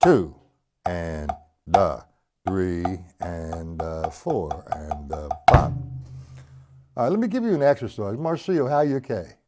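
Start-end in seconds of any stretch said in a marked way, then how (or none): 0.0-5.7 very slow, low, measured count; counting triplets. Shown as a demonstration. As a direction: count out each syllable slowly, popping "two". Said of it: "Now we count it".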